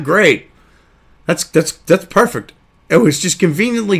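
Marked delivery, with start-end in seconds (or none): none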